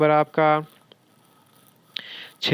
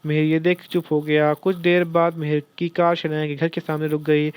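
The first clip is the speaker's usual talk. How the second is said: plain reading voice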